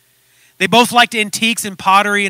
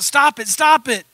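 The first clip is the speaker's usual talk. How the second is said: raised, protesting plea; crying out; 5 semitones higher, 4 dB louder than usual. Acted. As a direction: cry out for it to stop, pitched up. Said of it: "cried out".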